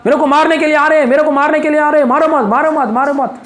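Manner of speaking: high, fast, pleading panic; imitated nightmare cries. Preacher imitates a man crying "don't hit me" at night, panicked cries. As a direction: act out rapid cries of someone attacked in sleep. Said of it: "चिल्लाना".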